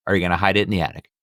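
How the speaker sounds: ordinary delivery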